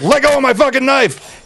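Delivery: high, urgent, laughing demand; frantic demand. Retold or imitated. Retelling the demand to the stabbed man, high and frantic. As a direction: blurt it out in a panic. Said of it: "I was like".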